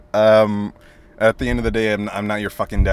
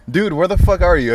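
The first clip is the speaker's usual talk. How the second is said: incredulous; 8 semitones higher, 6 dB louder than usual. Retold or imitated. Imitating friend's dreamy pose.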